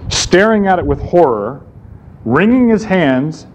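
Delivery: usual speaking voice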